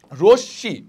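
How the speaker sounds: রশ্মি loud, as rosh-shi; doubled consonant. Shown as a demonstration. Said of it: "দ্বিত্ব হচ্ছে দুইবার উচ্চারণ হবে".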